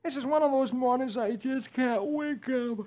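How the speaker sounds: high, drowsy comic Dagwood voice; sleepy Dagwood. Acted. Reading the comic to kids, high sleepy Dagwood voice. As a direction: yawn it out as a sleepy husband. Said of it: "And he crawls back into bed".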